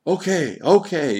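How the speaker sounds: loud, high exasperated cry; high-pitched outburst of surrender. A narrator's reading. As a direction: cry out as if giving in. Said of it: "Johann cried".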